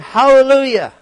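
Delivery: high, loud proclamation; multitude's praise. Retold or imitated. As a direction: proclaim it like a rejoicing crowd. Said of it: "a great multitude singing".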